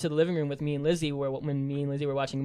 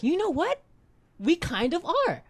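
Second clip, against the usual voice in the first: high, slow put-on voice; squeaky mock self-quote, slowed right down; mock agreement voice; 12 semitones higher, slower than usual. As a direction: play up a smug little agreement. Quoting his own reply, playful.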